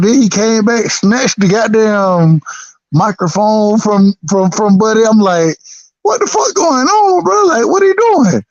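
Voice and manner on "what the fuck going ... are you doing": high, stunned what-is-going-on; reliving the shock, pitched way up; shocked disbelief. Retold or imitated. Friend retelling his reaction to the meltdown, high and incredulous.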